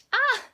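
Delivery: loud high joyful cry; a loud, high happy "ah!". Real, not acted. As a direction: burst out in delight. Joyful outburst after declaring.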